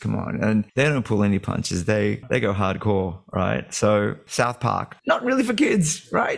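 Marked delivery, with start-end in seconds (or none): 5.1-6.4 squeaky-high put-on verdict; high mock voice. Guest judging cartoons for children, high mock voice. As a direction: jump way up for a mocking aside.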